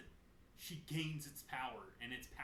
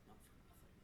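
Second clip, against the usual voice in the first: whispered threat; menacing whisper; 15 dB quieter, faster than usual. Retold or imitated. Sylvanas hissing at her enemy, a whisper. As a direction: breathe it like a villain.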